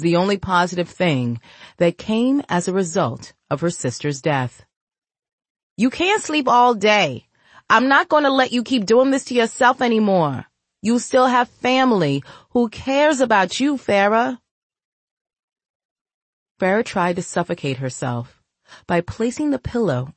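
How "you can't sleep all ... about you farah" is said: pleading sister. Acted. Mia pleads with her sister.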